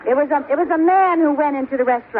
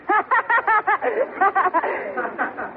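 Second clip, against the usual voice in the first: self-pleased laugh; 6 semitones higher, 3 dB quieter than usual. Acted. Wife laughs at own punchline.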